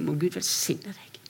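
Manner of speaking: slow whispered blessing. Retold or imitated. Blessing a persecuted pastor in a slow whisper. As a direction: breathe the blessing softly, slowly.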